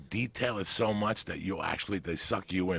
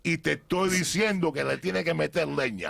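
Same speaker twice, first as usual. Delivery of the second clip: loud, high Spanish pep talk; fiery hype speech; 9 semitones higher, 3 dB louder than usual. Retold or imitated. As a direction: pump him up with passion. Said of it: "started saying who my mother is".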